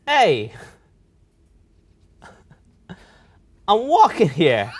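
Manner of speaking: loud, drawn-out New York shout; hammed-up New York accent, big and slow. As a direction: yell it like a New York pedestrian. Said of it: "give us a good, "I'm walking here".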